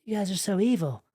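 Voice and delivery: exasperated complaint, acted out in another person's voice; acted upset. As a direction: play someone hurt and accusing. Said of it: "You're kind of upset and you're like".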